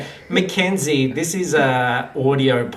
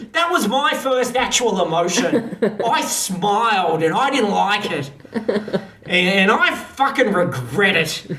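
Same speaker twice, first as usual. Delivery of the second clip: higher, hammed-up angry tirade; indignant niece; 5 semitones higher than usual. Niece Candace scolding Nathan from her airship, hammed-up indignation. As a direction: spit out mock outrage with swooping emphasis. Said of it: "Candace Kidman, the niece, is on there".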